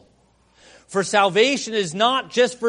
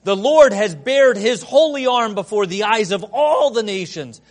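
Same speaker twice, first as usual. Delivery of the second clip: loud, high ringing proclamation; scripture read at full preaching force; proclaimed scripture; 4 semitones higher, 9 dB louder than usual. Acted. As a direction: cry it out like a prophet. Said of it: "He cries out to us".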